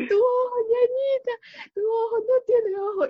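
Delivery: teasing brother hamming up the panic to his little sister; mock alarmed brother. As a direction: pretend to be horrified at her eye.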